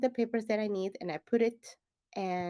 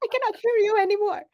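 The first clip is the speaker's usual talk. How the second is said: very high, fast, loud squeal; mock refusal to listen; 14 semitones higher, 9 dB louder, faster than usual. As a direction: drown out the nagging voice, playfully. Shutting out the inner voice.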